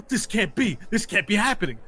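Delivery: high-pitched whining disbelief; stunned denial. Acted. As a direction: protest your defeat in a rising whine. Beaten fighter in disbelief.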